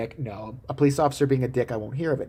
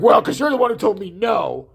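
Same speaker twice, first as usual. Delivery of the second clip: louder, higher mock-angry retort; mad officer; 5 semitones higher, 5 dB louder than usual. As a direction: snap back as the offended cop. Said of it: "But he still doesn't even mad".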